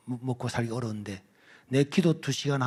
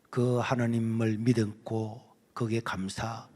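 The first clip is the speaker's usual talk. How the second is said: ordinary talk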